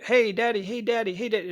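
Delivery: put-on high, nagging child voice calling daddy; pestering kids. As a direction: whine it up high like a kid pulling at you. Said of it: "your kids are like".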